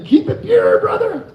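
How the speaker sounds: hammed-up shouted slogan; mock shout. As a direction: shout it out like a battle cry. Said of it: "What I meant to say was".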